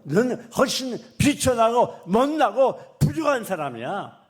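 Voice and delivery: loud, slow stern voice of God; God rebuking. Acted. God loudly and slowly rebukes the proud listener. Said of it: "하나님 여러분에게 뭐라 그런지 알아요".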